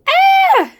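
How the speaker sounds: piercing, very high loud scream; shriek. A narrator's reading. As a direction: scream in sudden terror. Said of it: "shrieked Flap".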